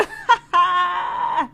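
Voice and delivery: high, loud burst of laughter; delighted laugh. Real, not acted. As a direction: crack up with delight. Laughing at cute guy.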